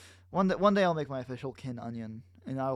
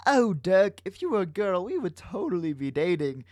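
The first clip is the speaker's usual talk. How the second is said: higher, loud opening, lightly put on; mock Jake; 4 semitones higher, 5 dB louder than usual. Jake speaking in Dirk's memory, lightly mocking. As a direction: lightly ham up a gushing line.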